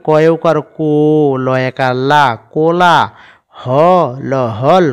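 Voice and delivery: ordinary delivery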